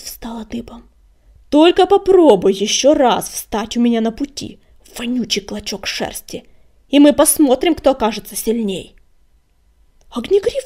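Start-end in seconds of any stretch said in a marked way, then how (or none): 1.5-8.9 angry threat. A narrator's reading. Acted threat to a rival.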